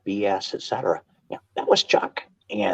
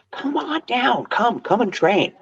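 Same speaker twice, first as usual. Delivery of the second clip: inviting call; slower than usual. Retold or imitated. Chuck calling Danny down warmly.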